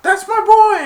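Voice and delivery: high, loud proud shout; a parent bursting with pride; proud cheer. Retold or imitated. Parents cheering their son's touchdown, high and loud. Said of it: "His parents went".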